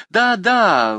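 high, loud eager yes; exclaiming. A narrator's reading. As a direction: jump in with eager agreement. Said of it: "воскликнул Леонардо". (восторженный возглас)

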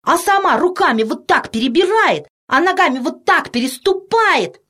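put-on high-pitched excited boy; chattering boy. A narrator's reading. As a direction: keep gushing like a thrilled little boy. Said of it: "ни на минуту не замолкал рыжий мальчишка". (восторженный мальчишка, высоким голосом)

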